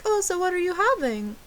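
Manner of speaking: high-pitched mock American waiter; American accent. Waiter taking her order at Applebee's, imitated high American accent. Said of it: "That's my American accent".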